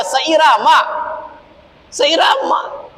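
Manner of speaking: Seirama said high and emphatic; stress on seirama. Real, not acted. Preacher driving home his point to the congregation, emphatic. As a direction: hit the word Seirama hard. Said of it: "stresses in tune".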